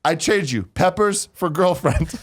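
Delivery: half-hammed foreign accent; mock broken English. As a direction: bargain in goofy broken English. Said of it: "And he goes".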